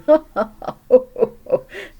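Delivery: high loud burst of laughter; elephant laugh. Acted. Elephant laughing at the funniest thing, loud. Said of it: "He began to giggle".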